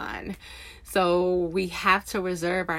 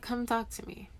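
softer, gentle quoted invitation; God's soft invitation; 4 semitones higher, 7 dB quieter than usual. Retold or imitated. Recalling God's call to talk, said softly and gently. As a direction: hush it like a patient, kind call. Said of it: "He's like".

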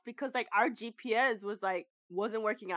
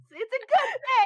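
high, louder mock passerby voice; cheerful stranger; 8 dB louder than usual. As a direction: ham up a jolly stranger. Imitating cheerful stranger on street.

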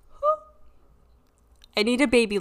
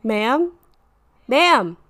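louder, rising urgent call; 2 semitones higher, 8 dB louder than usual. Acted. Officer calling after silence.

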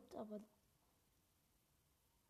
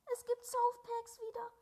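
high, loud, thrilled announcement; real excited gamer yelling; excited shout; 13 dB louder than usual. Real, not acted. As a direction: shout it like great news. Finding softpacks back in game.

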